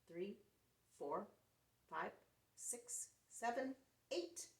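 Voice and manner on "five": plain reading voice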